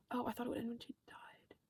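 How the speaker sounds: whispered aside to viewers. Real, not acted. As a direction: breathe it like a secret. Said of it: "aside to audience".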